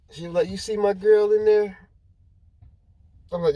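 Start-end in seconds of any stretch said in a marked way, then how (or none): 0.5-1.8 tearful coworker. Retold or imitated. Crying coworker quoted asking.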